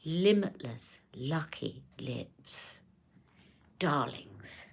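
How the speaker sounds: very slow, hushed, low impression; whispery luxury-brand purr, savouring every word; Charlotte. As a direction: breathe it out slowly, trailing into a whisper. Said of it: "I can do it in her accent".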